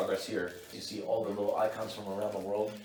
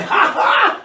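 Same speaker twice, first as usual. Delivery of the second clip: 1 burst of high laughter; 16 dB louder than usual. Real, not acted. Laughs at own blunder.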